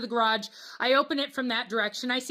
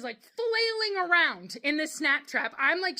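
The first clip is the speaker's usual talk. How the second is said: ordinary delivery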